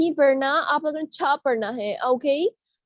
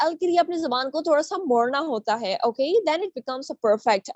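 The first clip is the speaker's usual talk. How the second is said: ordinary delivery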